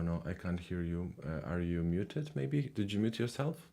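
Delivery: normal talking voice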